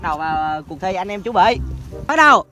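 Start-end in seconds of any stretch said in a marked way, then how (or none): 2.1-2.4 loud high call to start. Real, not acted. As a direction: shout the start signal. Calling out start of game.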